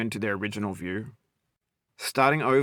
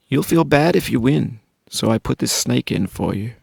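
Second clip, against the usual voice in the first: louder, pressed, teasing verse to the player; louder taunting declamation; 6 dB louder than usual. Acted. Narrator goading the struggling player, louder mock taunting. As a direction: lean in and needle them, loud.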